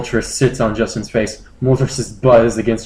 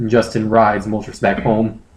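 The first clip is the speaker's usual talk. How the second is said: usual speaking voice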